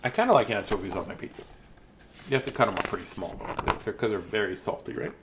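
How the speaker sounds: soft fast aside; quiet mumble. Real, not acted. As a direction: mutter it quickly and softly.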